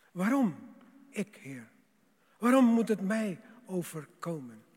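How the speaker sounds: pleading rhetorical outburst; anguished protest. Acted. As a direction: cry out the why, demanding an answer. Believer's protest to God, acted.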